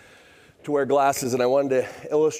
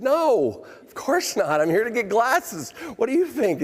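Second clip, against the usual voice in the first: mock incredulous; 12 semitones higher than usual. Teasing the optician who believed him, high mock disbelief. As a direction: act amazed she fell for it. Joke revealed to embarrassed optician.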